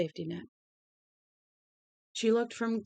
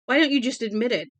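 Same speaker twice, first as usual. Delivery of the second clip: loud, high and fast accusation; furious scream; 5 semitones higher, 9 dB louder, faster than usual. A narrator's reading. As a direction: fling it at them in a rush. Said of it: "she screamed at them".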